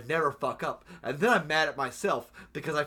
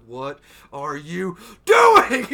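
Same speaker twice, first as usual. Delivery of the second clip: much louder, very slow exasperated shout; exasperated yell; 14 dB louder, much slower than usual. Retold or imitated. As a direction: bellow it at yourself, dragging each word.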